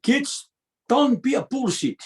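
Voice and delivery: put-on dad's high-pitched Tongan-accented broken English; mock Tongan-accented fatherly command. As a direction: imitate dad scolding in a thick Tongan accent. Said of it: "I'll just say it in his accent".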